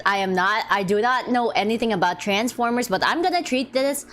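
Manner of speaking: ordinary talk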